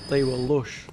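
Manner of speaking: "They were lush" with a broad northern English "lush" rhyming with "push"; northern accent. Shown as a demonstration. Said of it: "I read it in a really northern accent in my head".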